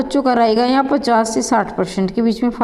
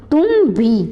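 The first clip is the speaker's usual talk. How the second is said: "तुम भी" with भी lifted in pitch; stress on भी; 3 semitones higher than usual. Shown as a demonstration. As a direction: demonstrate emphasis by stressing भी. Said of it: "देखो बोल कर देखना". (भी पर बल देकर)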